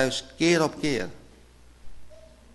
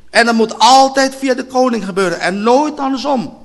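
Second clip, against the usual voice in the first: raised, driving sermon voice; fiery preaching; 8 semitones higher, 8 dB louder than usual. Acted. Preacher urges the congregation, loud and driving. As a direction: hammer the point home to the church.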